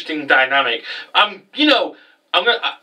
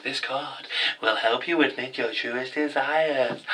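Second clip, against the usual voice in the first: soft, slow, eerie mystic voice; sinister stranger; 9 dB quieter, slower than usual. Retold or imitated. A mysterious man giving Blue Angel a card, soft sinister hush. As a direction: hand over the card like a cryptic villain. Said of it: "he's like".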